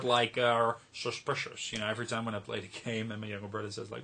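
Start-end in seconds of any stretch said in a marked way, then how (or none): 0.9-1.7 Sean Connery voice. Retold or imitated. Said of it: "I really like to say that word".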